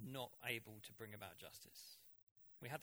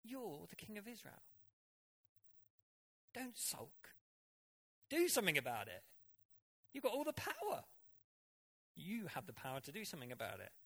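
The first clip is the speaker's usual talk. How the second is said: much higher, pushy coaxing wife voice; Jezebel goading; 9 semitones higher, 4 dB louder than usual. Retold or imitated. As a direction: egg the sulking king on, high and insistent. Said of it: "and then she goes".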